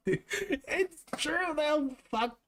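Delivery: slow, high, laughing through the words. Real, not acted. Streamer laughing at chat's joke, slow and high. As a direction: let the laugh carry it.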